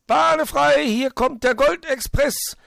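very high, exultant shout; loud whooping. A narrator's reading. Hoppel clears the piste as he races, loud whoop. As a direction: holler with reckless glee, drawing out the end. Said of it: "Hoppel hatte laut juchzend".

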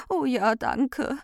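slow, high whimper. A narrator's reading. Ordnung thanks Recht for the help, whimpering. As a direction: whimper thanks through tears. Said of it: "wimmerte die Ordnung".

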